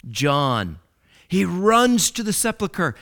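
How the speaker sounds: usual speaking voice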